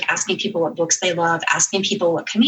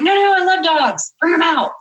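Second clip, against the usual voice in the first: higher, louder eager insistence; eager boss; 10 semitones higher, 4 dB louder than usual. Retold or imitated. Boss at a fancy dinner insists on seeing the dog, eager and higher. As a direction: gush that you love dogs.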